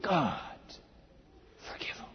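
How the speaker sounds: very soft, fading to a whisper; hushed, halting prayer; dying prayer. Acted. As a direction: breathe out a last plea. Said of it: "And Stephen's last prayer".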